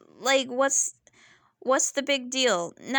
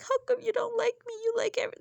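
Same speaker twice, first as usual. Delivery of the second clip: much higher, fast, whiny mock sobbing; mock whiny victim; 12 semitones higher, much faster than usual. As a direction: mimic her as a petulant crybaby. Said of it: "act like a victim, and start crying".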